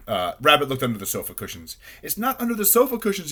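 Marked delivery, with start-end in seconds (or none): 2.0-3.3 high, panicky cry. A narrator's reading. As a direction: cry out in frustration, pitched high. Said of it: "he cried".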